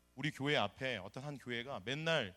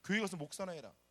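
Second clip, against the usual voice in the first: retold insult; 6 semitones higher, 4 dB louder than usual. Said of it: "이런 말도 안 되는".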